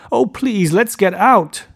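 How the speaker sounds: high, loud, desperate plea; pleading cry. A narrator's reading. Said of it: "cried Daisy".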